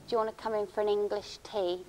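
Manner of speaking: a lightly faked English accent, imitating an American friend; American friend putting on a half-hearted English accent; bad English accent. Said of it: "in a bad English accent".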